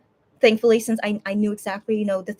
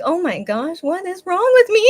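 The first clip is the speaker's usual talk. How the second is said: higher-pitched mock dismay at yourself; retold exasperation; 8 semitones higher, 6 dB louder than usual. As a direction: act out being baffled by your own tears. Retold exasperated reaction to own crying.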